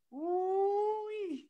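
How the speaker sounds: high, held woo-wee; excited woo. Real, not acted. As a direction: sing out a hype cry. Hype exclamation.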